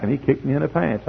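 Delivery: ordinary talk, nothing marked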